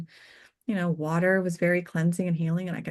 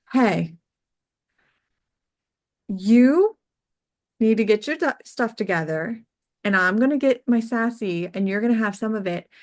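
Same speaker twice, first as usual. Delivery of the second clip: sassy client; 3 semitones higher, 5 dB louder than usual. Retold or imitated. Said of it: "She was so sassy".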